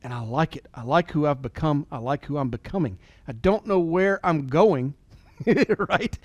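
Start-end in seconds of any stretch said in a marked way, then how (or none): none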